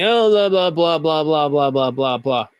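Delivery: droning blah-blah that slides steadily downward; mock monotone rambling. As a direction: imitate someone droning on, sinking in pitch. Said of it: "And then they continue speaking".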